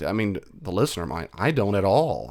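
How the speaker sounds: normal talking voice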